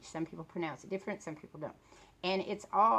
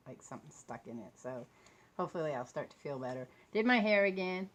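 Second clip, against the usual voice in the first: ordinary talk, nothing marked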